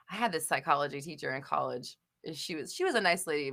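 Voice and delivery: ordinary talk, nothing marked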